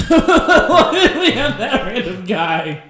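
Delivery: slow, loud, laughing question; laughing disbelief. Real, not acted. Reacting to absurd clip.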